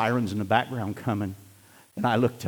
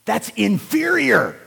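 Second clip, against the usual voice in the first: emphatic preaching; 12 semitones higher, 9 dB louder than usual. Acted. Preaching at full force.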